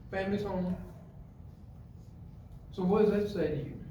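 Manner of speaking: ordinary talk, nothing marked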